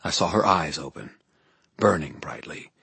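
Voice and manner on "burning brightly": usual speaking voice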